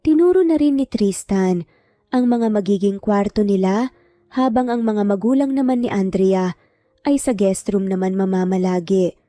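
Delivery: ordinary delivery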